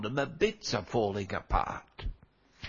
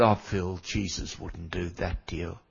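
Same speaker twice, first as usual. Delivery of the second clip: gentle reassurance; 6 semitones lower, 4 dB quieter than usual. Retold or imitated. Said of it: "I said".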